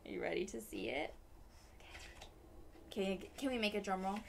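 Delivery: plain reading voice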